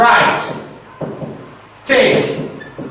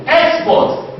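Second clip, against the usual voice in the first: "Export" said EX-port, with a raised first syllable; first-syllable stress; 6 semitones higher than usual. Shown as a demonstration. Teacher repeats the noun for students, "Export" stressed on EX. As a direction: lean on EX in "Export", the noun form. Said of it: "stress will be on the first syllable".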